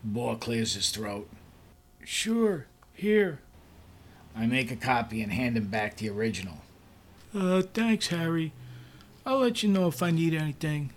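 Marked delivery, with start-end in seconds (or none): 2.0-3.4 lightly raised, higher voice for the new boss; Bohr's voice. Acted. New boss replying to the narrator, higher voice. Bohr answering, narrator voicing. 7.3-10.9 higher, hesitant boss voice; awkward new boss. Acted. New boss thanking his assistant, higher awkward voice. Bohr dismissing the narrator.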